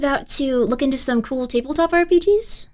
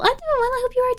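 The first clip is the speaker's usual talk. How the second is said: high sing-song voice; 12 semitones higher, 4 dB quieter, much faster than usual. Pretend. Reading chat message.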